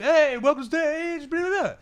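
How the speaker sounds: high, slow mock stand-up hello; comic greeting crowd. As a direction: ham up a comic's stage greeting. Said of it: "then they walk".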